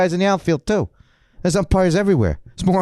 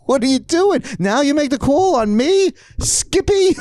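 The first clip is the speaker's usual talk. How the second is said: high-pitched, incredulous ranting; retold screaming; 12 semitones higher than usual. Ejected player rants at umpire Skippy, high incredulous yelling. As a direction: squeal the umpire's name in outrage. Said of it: "I'm screaming at Skippy".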